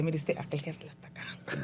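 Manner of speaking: near-whispered tip-off; confidential aside. Retold or imitated. Employee telling her boss about another boss, hushed and confidential. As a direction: murmur it low, as if reporting discreetly.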